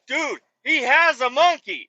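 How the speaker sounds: slow, high, loud insistence on "has"; insistent. Retold or imitated. Driver insisting to his coworker, slow and emphatic. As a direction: lean hard on "has", drawn out. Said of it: "And I'm like".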